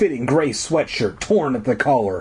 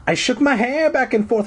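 high voice; 12 semitones higher than usual. Acted. Retake in strange voice.

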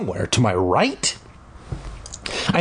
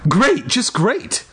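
high, slow hammed-up fuming; 12 semitones higher, slower than usual. A narrator's reading. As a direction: grumble it out in mock anger. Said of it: "I fumed".

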